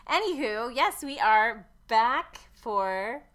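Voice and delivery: loud, slow, drawn-out welcome back; excited announcement. Real, not acted. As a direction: stretch the yes like a host. Podcast comeback announcement.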